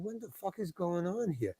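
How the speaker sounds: very quiet, baffled undertone; muttered aside. Retold or imitated. Bewildered actor to himself on set, muttered aside. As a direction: say it under your breath, barely audible.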